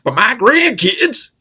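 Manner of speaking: high, slow southern drawl on grandkids; hammed-up Southern grandpa; southern accent. Grandpa guarding his grandkids, in a southern accent. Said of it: "I got the southern accent".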